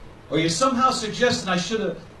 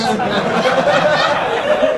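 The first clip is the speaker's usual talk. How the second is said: loud laugh; 5 semitones higher, 9 dB louder than usual. Real, not acted. Crowd laughs hard.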